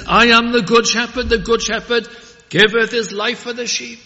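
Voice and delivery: loud, high ringing proclamation of "giveth"; loud pulpit proclamation; proclaimed. Acted. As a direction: preach "The good shepherd giveth" out full-voiced. Proclaimed loud, then repeated calmly.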